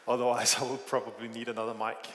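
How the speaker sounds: usual speaking voice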